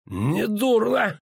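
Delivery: high, stretched-out exclamation; villain's grudging praise. A narrator's reading. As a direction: draw it out high, mockingly approving. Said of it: "кашлянул Бонард". (врастяжку, с издевательским одобрением)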